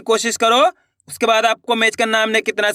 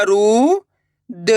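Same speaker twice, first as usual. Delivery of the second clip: long drawn-out syllables with pauses between; much slower than usual. Shown as a demonstration. Teacher sounding out a word for learners, syllable by syllable. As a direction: sound out each syllable slowly and separately. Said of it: "एक एक अक्षर का उच्चारण करके".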